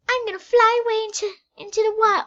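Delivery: high, fast cartoon creature voice; squeaky excited creature voice; character voice. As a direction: play the creature taking off in glee.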